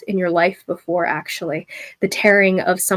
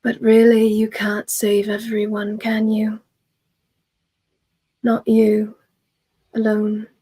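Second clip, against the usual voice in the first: lightly voiced mocking double; a touch of a sly echo of his own voice; same voice, not quite him; 2 semitones higher than usual. The inner double taunts him with his own voice. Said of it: "the same accent, the same tone, says".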